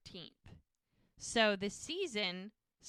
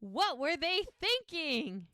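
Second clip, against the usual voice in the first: high, louder, slower disbelief; mock outrage; 8 semitones higher, 4 dB louder, slower than usual. Hope fuming over the wedding invitation, indignant. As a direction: play up Hope's indignation.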